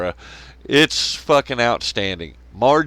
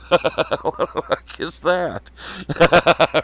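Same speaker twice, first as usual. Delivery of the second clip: slow words through real laughter; laughing disbelief; 5 semitones higher, much slower than usual. Real, not acted. Streamer cracking up at propaganda, laughing slowly. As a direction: laugh it out, incredulous.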